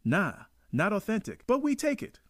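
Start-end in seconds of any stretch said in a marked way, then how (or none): 1.4-2.2 higher, stress on we; proud exclamation. Real, not acted. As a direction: say it proudly with the voice jumping up. Proud Jamaican claiming the film.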